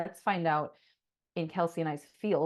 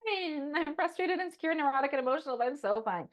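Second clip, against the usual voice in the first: fake fine; 11 semitones higher, 5 dB louder than usual. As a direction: chirp a forced "I'm fine" in denial. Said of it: "And I'm like".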